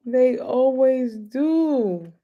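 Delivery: slow, high, drawn out; they always do, slowed right down; drawn-out repeat. Real, not acted. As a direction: stretch every word with a grin. Laughing repeat of the line.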